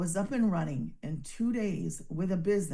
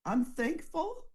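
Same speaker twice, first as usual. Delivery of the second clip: fighting tears; 9 semitones higher, slower than usual. Real, not acted. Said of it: "I'm not gonna cry".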